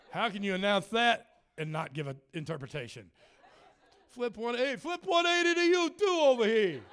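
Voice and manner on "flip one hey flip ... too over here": high, loud declaring preacher voice; mock prophetic proclamation. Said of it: "Who are you all of a sudden?".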